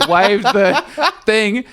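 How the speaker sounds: high, slow words through laughter; laughing while talking. Real, not acted. Storyteller cracking up over waving at the cashier, laughing.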